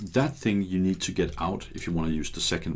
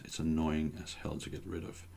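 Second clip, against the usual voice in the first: normal talking voice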